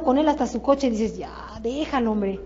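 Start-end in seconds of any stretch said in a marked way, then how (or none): 1.2-2.4 low, soft, fed-up groan of words; weary exasperation. Acted. Coach fed up with recruiter chasing prospect to car, weary. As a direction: sigh it out, low and worn down. Voicing exasperation at overlong pitch.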